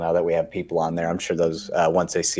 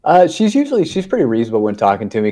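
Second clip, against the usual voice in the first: usual speaking voice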